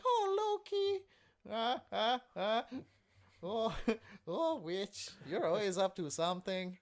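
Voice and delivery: high, squealing fake Spanish accent; bad Spanish accent. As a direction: ham up a terrible Spanish accent. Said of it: "That's my bad Spanish accent".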